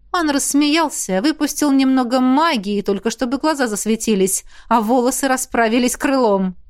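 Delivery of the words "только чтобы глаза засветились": ordinary delivery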